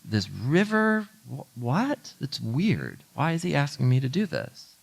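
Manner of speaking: half-hearted baffled scoffing; incredulous. Retold or imitated. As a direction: scoff at the odd order. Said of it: "he's clearly like".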